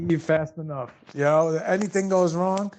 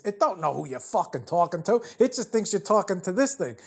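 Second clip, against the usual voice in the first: ordinary delivery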